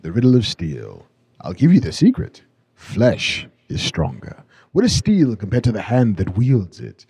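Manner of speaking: put-on villain lecturing a boy, deep and emphatic; emphatic movie villain. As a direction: lean on every word like a warlord teaching a boy. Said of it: "He was so emphatic".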